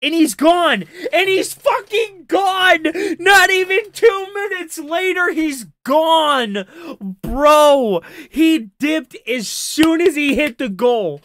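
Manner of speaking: yelling because the streamer left right at the goal; loud outraged laugh-yell. Real, not acted. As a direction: holler that he bailed.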